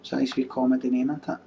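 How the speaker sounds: usual speaking voice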